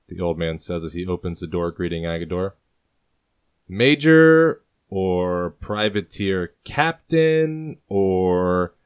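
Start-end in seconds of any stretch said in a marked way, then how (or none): 3.7-8.7 slow, drawn-out smug remark. Acted. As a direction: savour the jab slowly. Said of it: "Agador replies smugly".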